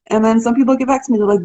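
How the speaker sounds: ordinary talk, nothing marked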